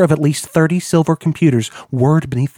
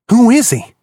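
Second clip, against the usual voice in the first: much higher, louder sharp question; urgent demand; 9 semitones higher, 6 dB louder than usual. A narrator's reading. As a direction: demand it, alarmed. Alarmed friend demands an answer.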